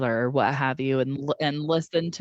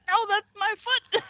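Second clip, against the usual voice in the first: high, squealing retold protest; high indignant yelp about her foot; mock yelp. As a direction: squeak it out like she just got stepped on. Jane caught in a brawl.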